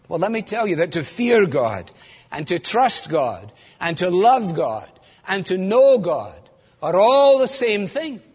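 usual speaking voice

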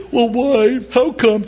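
high, pleading whine; whiny counselee. Retold or imitated. A counselee whining to the pastor, high and pleading. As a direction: whine like someone seeking comfort. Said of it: "people will come to you and say".

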